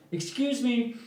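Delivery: high, bright call across the lot. Retold or imitated. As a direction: call after them to turn around. Calls out to departing customers.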